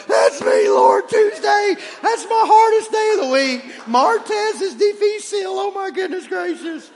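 high, loud mock altar cry; hammed-up crying out. Worshipper calling to God about Tuesday, hammed-up cry. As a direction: holler it out in comic desperation.

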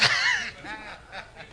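high burst of laughter. Real, not acted. Preacher cracking up at his wife's chicken greeting, high laugh. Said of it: "I laugh every time".

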